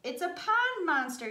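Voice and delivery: high, loud frightened cry; mild mock wail. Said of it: "you wail".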